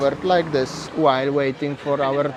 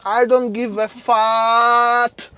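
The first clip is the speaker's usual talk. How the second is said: slow, louder, punning comeback; defiant retort; 7 semitones higher, 5 dB louder, much slower than usual. Real, not acted. Answering a friend's fat joke, slow defiant comeback. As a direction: drag out the comeback to shrug off the insult.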